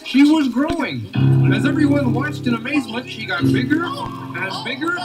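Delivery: ordinary delivery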